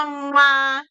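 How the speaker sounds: blown kiss smack; kiss sound. Shown as a demonstration. Therapist shows parents blowing kisses, kiss smack. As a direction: blow a loud kiss. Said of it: "tirar besitos".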